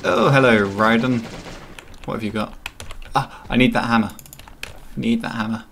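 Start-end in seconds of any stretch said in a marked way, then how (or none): none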